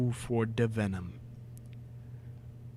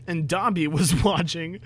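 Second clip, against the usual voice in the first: ordinary talk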